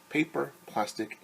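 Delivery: ordinary delivery, nothing marked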